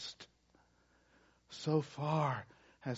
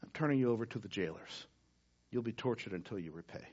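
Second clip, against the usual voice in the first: cold sentence; 4 semitones lower, 9 dB quieter, faster than usual. Acted. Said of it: "you don't know what forgiveness means".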